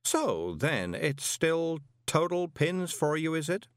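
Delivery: slow, pointed, giving him one last chance; drawn out like a final offer to a fool; last chance warning. A narrator's reading. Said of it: "as if giving a fool one last chance".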